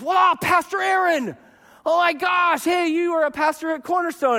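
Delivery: a churchgoer thrilled to spot a pastor; gushing fan. Retold or imitated. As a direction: gush like a starstruck fan. Fan gushing over pastor friend.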